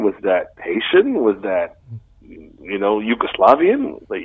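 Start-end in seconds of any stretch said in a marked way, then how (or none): none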